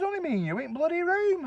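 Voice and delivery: high, put-on indignant insistence; mock accusing. Blaming the husband as a joke, mock indignation. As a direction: play the wronged man, hammed up. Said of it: "You know, joking like".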